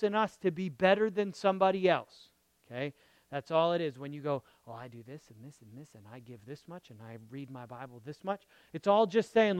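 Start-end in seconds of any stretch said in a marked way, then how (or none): 4.7-8.4 quick, low murmured list; soft, low imitation of a self-promoter; mock humble boasting. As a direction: rattle off your good deeds under your breath. Mimicking a self-satisfied list of deeds.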